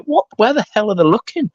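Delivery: a touch of mock bewilderment; baffled. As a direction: sound lost, like everyone sees it but you. Retold bafflement at unseen thing.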